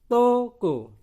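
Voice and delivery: "toko" loud and high, toh-koh; sounded-out close O. Shown as a demonstration. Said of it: "maka tulisannya dibaca".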